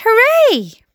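a high, loud hooray from the crowd; cheering shout. Acted. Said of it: "about a dozen people cried".

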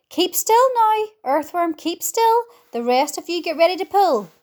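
ordinary delivery

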